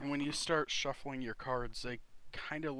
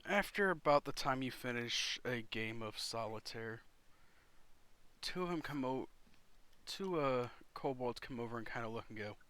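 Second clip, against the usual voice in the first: ordinary talk, nothing marked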